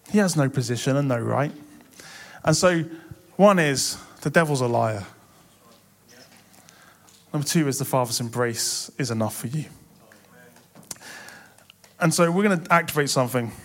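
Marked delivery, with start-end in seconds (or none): none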